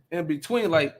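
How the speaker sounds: ordinary delivery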